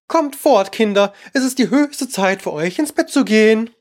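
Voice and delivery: high-pitched little bird voice; piping, trembling. A narrator's reading. Canary to its little ones, piping. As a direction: lightly pipe an anxious call. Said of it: "piepte zitternd zu seinen Kleinen".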